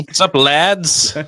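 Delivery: put-on loud, high, drawn-out English-accent greeting; hammed-up English accent, stretched and loud; mock English accent. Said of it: "my English accent".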